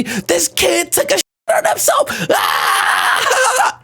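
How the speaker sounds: high squealed taunt into mock laughter; jeering schoolkids. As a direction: point and howl like a mocking kid. Acted older kids laughing at him.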